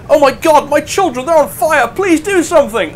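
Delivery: high, loud, hammed-up panic about the burning children; a villager shrieking for help in mock terror. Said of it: "He goes".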